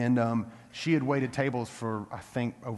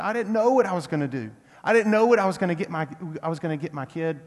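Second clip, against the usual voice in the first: higher, louder retold tearful outburst; imitated crying; 5 semitones higher, 6 dB louder, faster than usual. Mother overwhelmed by gifts for her kid, imitated crying. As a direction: imitate a tearful mother, words tumbling out quickly. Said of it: "you know, she's crying".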